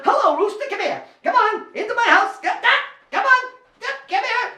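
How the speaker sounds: high coaxing call; coaxing rooster. Acted. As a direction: coax the rooster in.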